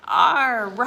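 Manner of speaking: R said loud and drawn out; loud, teacherly sounding-out of R. Shown as a demonstration. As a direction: give the beginning sound of R, emphatically. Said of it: "what's the beginning sound we keep hearing".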